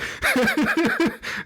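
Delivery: breathy high burst of laughter. Real, not acted. Gamer amused by father and son, high laugh.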